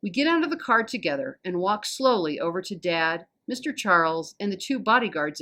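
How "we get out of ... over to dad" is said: plain reading voice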